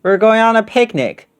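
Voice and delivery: bubbly with delight; delighted boy. Acted. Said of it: "He was delighted to see her".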